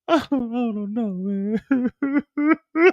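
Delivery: high, slow blubbering; mock crying. Mireya crying at work to her coworker, hammed-up sob. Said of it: "Mireya's like".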